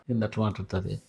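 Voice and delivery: very soft, low aside; hushed, puzzled remark to oneself; muttered aside. Acted. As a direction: mutter it under your breath, puzzled. Puzzled aside about the patient.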